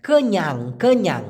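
কেন্যাং said with a nasal ng ending, twice; Malay pronunciation. Shown as a demonstration. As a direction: say কেন্যাং as ke-nyang, ending on a ringing ng. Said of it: "উচ্চারণটা কীরকম হবে".

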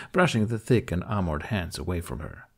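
ordinary delivery

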